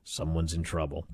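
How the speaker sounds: quiet amused inner thought. Retold or imitated. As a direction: think it to yourself, amused. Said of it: "I thought".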